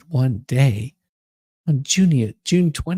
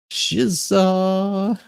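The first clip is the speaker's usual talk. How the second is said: silly mispronunciation; 7 semitones higher than usual. Real, not acted. Playful twist on "Jigsaw.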